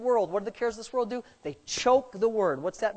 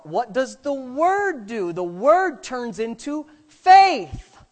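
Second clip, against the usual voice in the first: slow, louder, rising preaching; full-force preaching; 4 semitones higher, 6 dB louder, slower than usual. Acted. Preacher teaching the congregation about faith, slow loud preaching. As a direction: drive each phrase home slowly.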